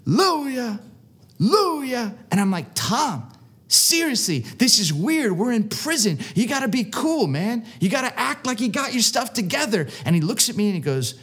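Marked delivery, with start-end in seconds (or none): 0.0-2.2 high, ringing retold holler; screamed call. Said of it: "he just keeps saying it". 2.7-9.9 high-pitched, urgent mock pleading; exasperated plea. Retold exasperated plea to friend.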